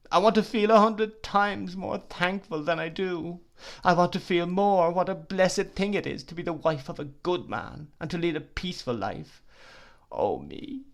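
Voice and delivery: high, pleading, grief-stricken weeping; agonised supplication. Acted. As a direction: beg everyone for help in anguish. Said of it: "supplication, which in its agony of grief".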